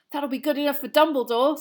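happy. A narrator's reading. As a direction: say it glad, with a lift on Dumbledore. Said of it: "said Harry happily".

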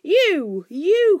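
high, loud, drawn-out accusation; furious high shout; enraged cry. A narrator's reading. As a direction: cry it out in outrage. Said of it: "cried Thorin".

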